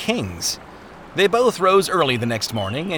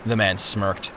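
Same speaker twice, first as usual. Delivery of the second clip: plain reading voice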